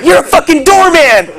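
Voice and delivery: very high shrieking yell; mock screaming. Movie woman screaming at the doorman, high shrieking rant. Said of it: "She's like".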